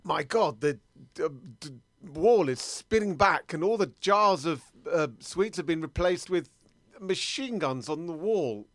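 loud, halting exclamation of shock; mock amazement. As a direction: stammer it out loudly, stunned by the discovery. Discovers hidden machine guns in shop.

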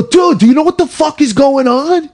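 yelling out the car window in panic; retold yell. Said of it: "I yell at this fucking".

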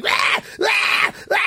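very high shouted rah rah rah; kids shouting. Retold or imitated. Spoiled kids in the back seat, very high shouting. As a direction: bellow like a wild screaming kid. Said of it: "the other kids going".

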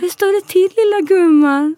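high-pitched imitation of a Scanian-Danish accent; imitated Scanian dialect, pitched well up. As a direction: put on a sing-song Danish or Scanian greeting. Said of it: "jag tror den var dansk eller skånsk".

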